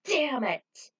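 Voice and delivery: loud, short curse; frustrated curse. A narrator's reading. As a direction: spit out the frustration.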